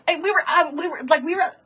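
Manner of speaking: usual speaking voice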